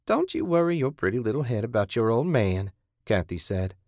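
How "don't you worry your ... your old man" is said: higher-pitched, patronizing captor; mocking captor. Kathy to captive about her husband, patronizing. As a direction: soothe the captive with false concern.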